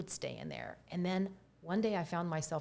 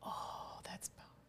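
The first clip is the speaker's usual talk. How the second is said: hushed, moved whisper; whispering in awe at the remark; awed whisper; 12 dB quieter than usual. Real, not acted. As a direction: breathe it out, struck by the words.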